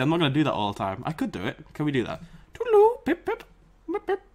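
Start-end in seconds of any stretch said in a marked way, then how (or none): 2.5-4.2 very high falsetto, pip pip cheerio; hammed-up high posh lady; posh female voice. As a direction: chirp it like a posh English lady. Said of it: "Doesn't work".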